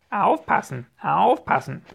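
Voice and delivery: high, loud repeated squawk; shrieked bird warning; screaming parrot. A narrator's reading. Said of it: "schrie der Papagei".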